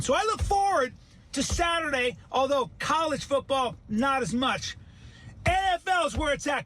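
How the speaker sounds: high-pitched, carried-away pitch; worked up. Pretend. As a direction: get too excited selling it. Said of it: "I'm sorry I'm getting all worked".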